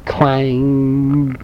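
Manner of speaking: plain reading voice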